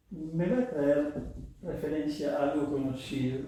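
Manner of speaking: usual speaking voice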